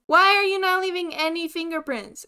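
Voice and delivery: loud, high exasperated demand; getting mad. Real, not acted. Player demanding fingerprints from a ghost, angry yell. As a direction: yell the question in frustration. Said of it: "I'm getting mad".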